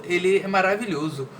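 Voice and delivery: ordinary talk, nothing marked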